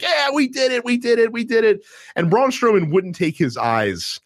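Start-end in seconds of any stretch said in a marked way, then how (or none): 0.0-1.8 high, gleeful cheering as Triple H; mock celebration. Triple H celebrating his win over Shane, high gleeful cheer. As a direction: crow the victory in a high voice.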